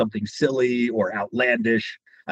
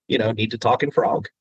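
ordinary talk

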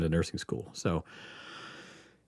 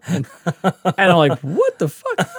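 laughing disbelief, higher; 9 semitones higher, 6 dB louder, slower than usual. Real, not acted. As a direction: laugh out the shock. Laughs retelling his shock.

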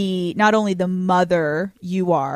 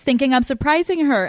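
normal talking voice

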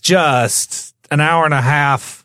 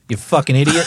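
ordinary delivery

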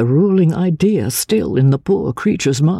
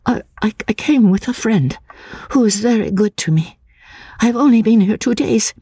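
high, hushed, faltering; stammering young woman; 9 semitones higher than usual. Acted. Anne explaining to the narrator, high and faltering. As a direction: stumble nervously into the answer.

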